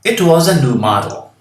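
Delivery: "It was a new model" read loud and bright, T flapped; reading example aloud loudly. Shown as a demonstration. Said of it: "Vejam o que acontece aqui com esse T".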